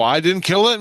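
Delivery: alarmed disbelief. Retold or imitated. Dreamer asking about the animal heads, alarmed disbelief. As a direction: blurt it out, pitched well up.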